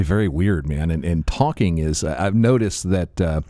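ordinary talk, nothing marked

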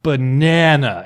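"Banana" drawn out, loud on the middle syllable. Shown as a demonstration. Mocking how puzzled friends answer, overstressed middle of "Banana". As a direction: stretch "Banana" slowly as ba-NAA-na. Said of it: "say it like that".

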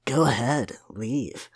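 low, soft, menacing Shadow; Shadow, grim. Acted. Co-host voices Shadow warning Tails to go ahead, soft and grim. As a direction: drop it quiet and ominous. Reads Shadow's comic lines.

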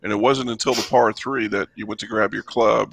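ordinary delivery

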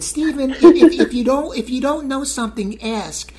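high mom voice, loud on the ifs; squeaky high-pitched mom impression. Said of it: "why I do my mom's voice like that".

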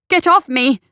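high, loud angry snap; snapped. A narrator's reading. Sonia throwing her burning rescuer off her, angry snap. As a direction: bark it as you shove him off. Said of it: "she snapped".

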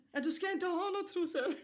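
can't keep a straight face; laughing. Retold or imitated. Retelling the nurse's reply about underwear, laughing.

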